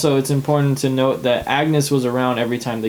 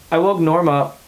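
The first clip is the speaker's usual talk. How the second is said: mock panic; 4 semitones higher than usual. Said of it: "He's freaking out".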